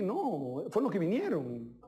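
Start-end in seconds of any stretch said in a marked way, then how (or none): none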